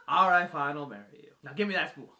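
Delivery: loud, high, hammed-up grudging agreement; grudging Theseus. Theseus caves to Ariadne and demands the spool, loud and grudging. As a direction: give in loudly, then grab for it. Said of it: "she's like".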